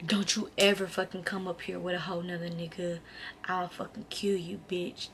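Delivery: very low-volume, controlled imitation of a threat; retold menacing threat. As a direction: act out his threat under the breath. Acts out his choking threat.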